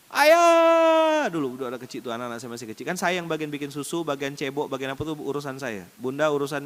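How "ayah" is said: long, high, loud call for dad; drawn-out mock wail; angry child's demand. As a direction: imitate a child yelling for father, stretched out. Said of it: "waktu dia minta marah-marah".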